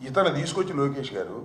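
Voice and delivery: ordinary delivery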